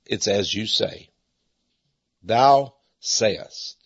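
low, slow, calm reply. Retold or imitated. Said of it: "And Jesus very calmly said".

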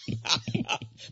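a quick airy giggle; breathy high laugh. Real, not acted. Host laughs off the few rough minutes of marriage, airy giggle. Laughing at his own aside.

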